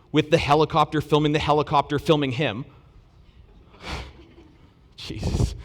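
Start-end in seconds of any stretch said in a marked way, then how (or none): 3.8-5.5 breathy whispered exasperation; exasperated sigh. Real, not acted. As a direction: sigh it out under your breath. Aside while waiting on slide.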